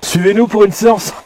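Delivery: loud call to the spirits; calling out loudly. Real, not acted. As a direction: call them to follow.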